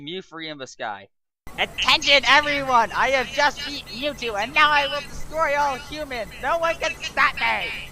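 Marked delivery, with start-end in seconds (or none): 1.6-7.9 high, loud villain proclamation; hammed-up evil Pokemon declaring doom; villain announcement. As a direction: boom out your plan to destroy humans. Villain Mew three announcing its plan.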